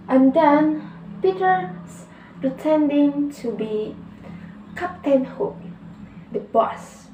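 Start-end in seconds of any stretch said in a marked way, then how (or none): none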